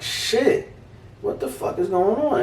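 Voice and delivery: high, startled yelp of disbelief; shocked reaction. Retold or imitated. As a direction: jump up in pitch, stunned. Shocked inner reaction to punch.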